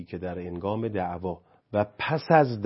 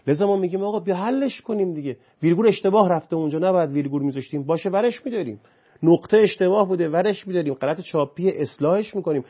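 higher, louder, fast heated preaching; impassioned lecture; 7 semitones higher, 6 dB louder than usual. Real, not acted. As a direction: drive the point home with rising passion. Impassioned lecturing, rising intensity.

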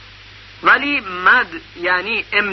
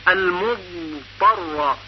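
slow Quranic recitation; much slower than usual. Shown as a demonstration. Teacher recites the verse slowly to show elongation. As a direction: chant المضطر unhurried, holding the ض. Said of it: "استطالهٔ زاد".

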